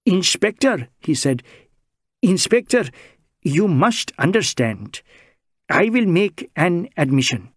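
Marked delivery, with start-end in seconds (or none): 0.0-0.9 put-on higher, lilting Indian-accented voice, anxious and earnest; Bengali social worker. Bengali social worker protesting to the inspector, higher Indian-accented voice. 2.2-7.5 put-on higher, lilting Indian-accented voice, anxious and earnest; Bengali social worker. Bengali social worker protesting to the inspector, higher Indian-accented voice.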